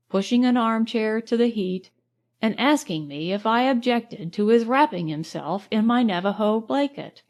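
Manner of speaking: ordinary delivery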